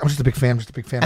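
lightly imitated repetitive mumbling plea; Rain Man. Cowed heckler looking down before the angry wrestler, lightly imitated babbling. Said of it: "must sound like Rain Man".

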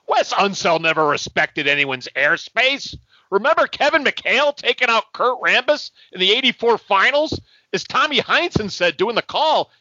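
put-on cranky old-timer yelling at refs; grumpy old fan complaining; old man rant.

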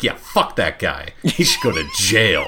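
laughing, mock-righteous yell at the end; hammed-up viewer condemning the guy through laughter; laughing mock outrage. Watching Cops, mocking the viewer's own outrage, laughing.

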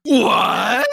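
high, loud shocked cry; stunned outburst at a friend's pick; shocked what. Real, not acted. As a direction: gasp it out in disbelief. Shocked at the Space Jam pick.

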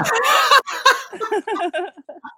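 big real belly laugh. Real, not acted. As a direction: burst out laughing. Caller's wheelchair joke.

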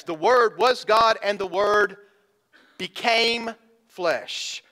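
"became flesh" slowed right down; stress on became. Shown as a demonstration. Preacher driving home "became" to believers, slow and emphatic. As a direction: stretch "became" slowly and let it land. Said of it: "those two verbs".

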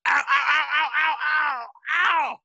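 high fake pained ows; hammed-up yelps of pain; faking pain. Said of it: "I went".

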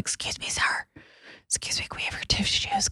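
hushed whisper during a prayer; whispered plea. Retold or imitated. Friend whispering to an older guy during prayer, hushed. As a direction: whisper it so nobody hears. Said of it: "my friend was like".

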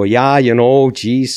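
put-on a light Minnesota accent, "you know, geez" drawn out folksy. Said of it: "we would sit there and go".

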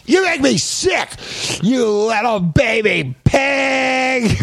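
very high, drawn-out mock shriek; screechy comic rage, slow and high; angry caricature artist. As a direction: stretch each word in hammed-up disgust. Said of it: "Here's the angry caricature artist".

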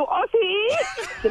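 high mocking echo of the caller's catchphrase. Fed-up wife mocking the caller's oh sí. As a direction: parrot her back with scorn. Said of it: "ya me aburriste con tu".

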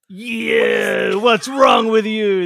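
loud, slow disgusted outburst; outraged Italian. Retold or imitated. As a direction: bellow your disgust at the dish. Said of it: "You'd be like".

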